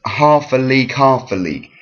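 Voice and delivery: HALF a league, each half punched then falling; falling dactylic stress. Shown as a demonstration. Teacher demonstrating the meter, falling dactylic stress. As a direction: hit half, let "a league" drop. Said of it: "one stressed syllable and two unstressed".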